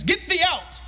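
a hint of archaic commanding voice; mock King James Jesus. Preacher imagining Jesus ordering out the mourners in King James English. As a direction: command in lofty old English. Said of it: "Did he speak in Old King James?".

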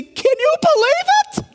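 high, giddy amazement, pitch leaping up; excited can you believe it; amazed disbelief. Pretend. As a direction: marvel at it like a wide-eyed fan. Disciple marvelling at Jesus.

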